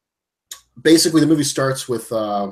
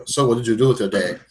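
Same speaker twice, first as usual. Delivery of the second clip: mock Irish accent. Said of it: "he's speaking really Irish".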